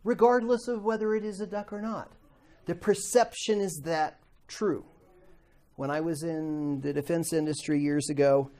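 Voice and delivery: usual speaking voice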